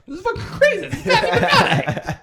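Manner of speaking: high laughing disbelief; awestruck fan. Retold or imitated. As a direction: squeal with amazed delight. Audience member awestruck.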